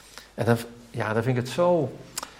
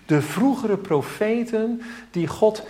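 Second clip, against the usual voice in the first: raised pulpit fervour; fervent preaching; 9 semitones higher than usual. Acted. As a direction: preach it out with rising urgency. Preaching at full force.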